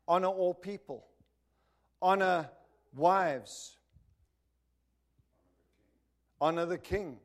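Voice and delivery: loud, rising sermon cadence; preaching. Acted. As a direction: preach it with full force, building. Preaching at full force.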